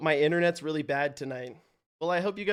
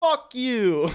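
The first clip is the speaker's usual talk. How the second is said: imitated angry driver; 6 semitones higher, 4 dB louder than usual. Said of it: "he was expecting me to say".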